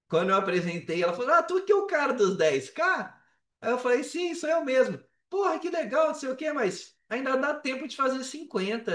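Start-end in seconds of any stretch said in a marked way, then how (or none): 1.3-3.1 mentor's voice. Retold or imitated. Mentor voiced greeting him. 4.0-5.0 proud reply. Retold or imitated. His own proud reply retold. 5.3-9.0 mentor's voice. Retold or imitated. Mentor voiced challenging him.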